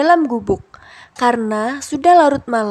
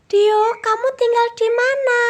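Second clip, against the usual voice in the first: very high, tiny butterfly; a small fluttery creature asking sweetly; high squeaky butterfly question; 10 semitones higher than usual. A narrator's reading. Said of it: "tanya kupu-kupu".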